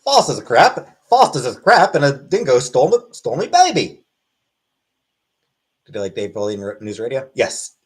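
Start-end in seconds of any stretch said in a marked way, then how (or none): 0.0-4.0 higher, louder mock Aussie wail; Australian accent. Streamer riffing on Australia, hammed-up Australian accent shouting the dingo line. Dingo stole my baby quote.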